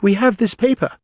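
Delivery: high, loud interjection; great hurry. A narrator's reading. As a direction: announce it urgently. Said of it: "jumping up in a great hurry".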